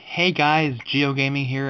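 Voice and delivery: ordinary talk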